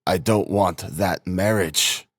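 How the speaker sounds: slow, laboured statement; with an effort. A narrator's reading. Sick brother to Dunya about her marriage, slow effortful speech. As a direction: push each word out slowly. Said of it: "continued with an effort".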